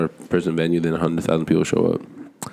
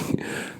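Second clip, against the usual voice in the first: short breathy laugh retold; imitated nervous laugh; 10 dB quieter than usual. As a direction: mimic a guy's helpless chuckle. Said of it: "I don't know why I'm laughing".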